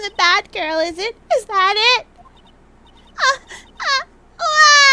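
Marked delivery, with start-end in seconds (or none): 3.2-4.8 high, loud hammed-up wah of a cry; fake wail. Cornered witness bursting into a mock wail. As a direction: bawl like a brat caught out.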